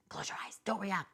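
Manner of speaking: hushed whisper, calming herself down; soft self-talk whisper against the trolls. Retold or imitated. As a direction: whisper it under your breath to stay calm. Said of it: "I say to myself".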